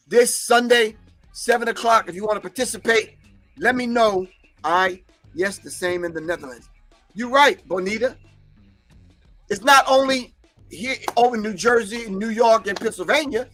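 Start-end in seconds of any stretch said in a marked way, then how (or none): none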